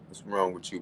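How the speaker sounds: ordinary talk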